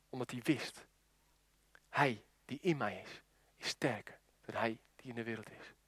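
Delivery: low, very soft, with long pauses; hushed preaching. Real, not acted. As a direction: bring the sermon down to a reverent hush. Hushed sermon climax. (ingetogen prekend, laag en zacht)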